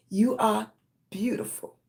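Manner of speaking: breathy whispered lyric; whispering. Acted. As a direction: whisper it tenderly. Whispered line of the song.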